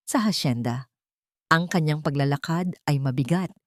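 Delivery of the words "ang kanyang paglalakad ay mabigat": ordinary delivery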